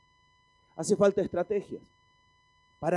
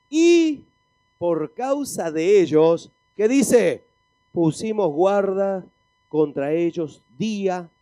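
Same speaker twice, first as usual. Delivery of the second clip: slow, deliberate verse reading with forceful peaks; emphatic scripture preaching; 8 dB louder, slower than usual. Acted. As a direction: draw each phrase out for the congregation. Preaches verse slowly to congregation.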